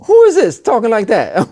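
acted outrage, voice lifted; indignant challenge. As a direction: call out an insolent enemy. Acts out David challenging Goliath.

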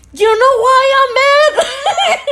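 high, slow mock-furious shout; acted angry outburst. Fed-up woman exploding at her drunk date, angry shout. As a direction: blow up at him, stretching it out loud and high. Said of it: "Now we're in a full bl-, blown out argument".

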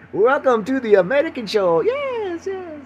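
loud, high mock Eastern European host; put-on Eastern European accent, rising into falsetto. Host welcoming all to the American show, Eastern European accent. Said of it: "I sound like I'm some Eastern European country".

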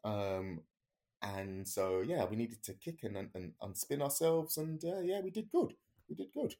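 high, bright-spirited explanation; cheery instructor. Retold or imitated. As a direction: make light of the danger. Instructor explaining twisted chute.

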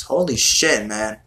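usual speaking voice

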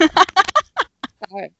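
high, loud laughing fit. Real, not acted. Host laughing mid-episode, high loud laugh.

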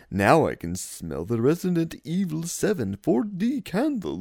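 put-on vampire voice with a Transylvanian accent. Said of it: "I have to do this in vampire voice".

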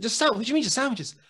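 fast, high, loud disbelief; incredulous. Retold or imitated. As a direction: rattle it off in shocked outrage. Sam disbelieving, wound up.

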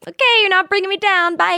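fast, airy dismissal; breezy brush-off. Pretend. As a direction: brush them off, unbothered.

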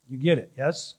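ordinary delivery

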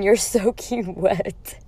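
cracking up mid-line; laughing. Real, not acted. Laughing retelling bouncer line.

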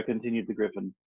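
ordinary delivery, nothing marked

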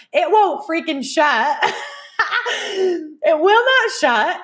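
high, drawn-out words between laughs; high-pitched and cracking up; laughing exasperation. Real, not acted. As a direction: stretch each word in giggly disbelief. Exasperated, laughing about the stuck trunk.

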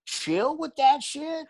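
high, slow mocking drawl; mock menace. Real, not acted. Comedian warns the boyfriend, slow and mocking. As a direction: drag it out to tease.

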